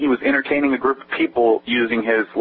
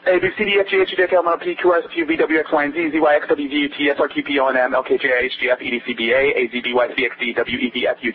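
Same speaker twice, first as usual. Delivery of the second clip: rattled off in a single breath; all in one breath. Shown as a demonstration. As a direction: recite it nonstop without stopping to breathe. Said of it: "I can do in one breath the alphabet forward".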